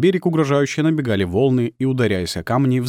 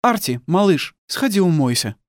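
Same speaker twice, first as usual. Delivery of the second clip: motherly request; 8 semitones higher, 3 dB louder than usual. Acted. Mother gently sends son away.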